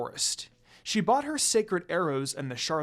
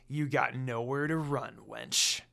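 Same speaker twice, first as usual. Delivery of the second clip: smirking evil; 3 semitones lower than usual. Acted. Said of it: "Inuyasha smirked at her evilly".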